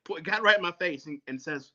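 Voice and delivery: ordinary talk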